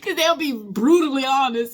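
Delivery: normal talking voice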